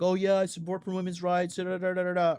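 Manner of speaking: mock fake agreement. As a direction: parrot insincere support, trailing into filler syllables. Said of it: "because they wanted to lie, be like".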